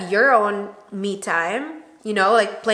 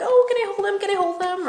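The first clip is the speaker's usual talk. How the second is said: much higher, squealing eagerness; eager begging; 11 semitones higher, faster than usual. Retold or imitated. To girlfriends with babies, gushing eager plea. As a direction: plead like you can't wait to hold it.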